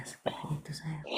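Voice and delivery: a secret whispered to a friend; whispering. Real, not acted. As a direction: lean in and breathe the words softly. Intimate reading to a viewer.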